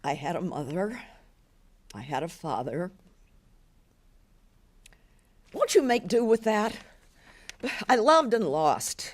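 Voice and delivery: a hint of a weary old woman, reluctant to speak; understated old woman musing to herself; old woman remembering.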